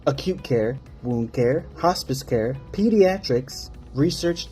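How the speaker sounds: ordinary delivery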